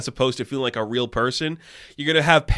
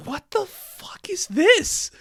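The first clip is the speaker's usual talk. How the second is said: incredulous whisper; much slower than usual. Real, not acted. Mocking the show's acting.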